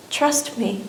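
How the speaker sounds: hushed, calm reassurance; God's quiet voice. Retold or imitated. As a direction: say it softly, as a divine answer. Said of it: "he spoke to me, and he said".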